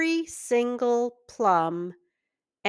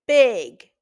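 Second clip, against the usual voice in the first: usual speaking voice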